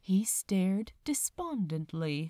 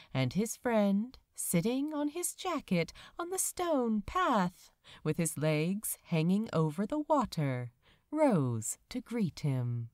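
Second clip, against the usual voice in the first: usual speaking voice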